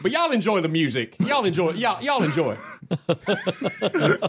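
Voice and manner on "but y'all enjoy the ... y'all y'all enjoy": a hint of a folksy Southern host; mild Southern accent sending folks off. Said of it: "don't know where that accent is from".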